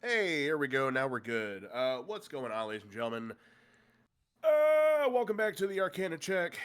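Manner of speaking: ordinary talk, nothing marked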